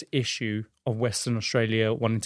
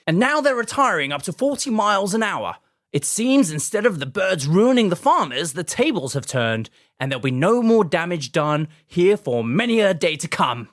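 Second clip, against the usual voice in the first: put-on high, louder newsreel narrator accent; old newsreel Mid-Atlantic accent; 5 semitones higher, 5 dB louder than usual. Podcaster voicing the emus' retreat as newsreel narrator, high Mid-Atlantic accent. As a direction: announce it like Mid-Atlantic propaganda footage. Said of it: "and then the narrator says".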